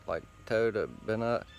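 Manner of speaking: ordinary delivery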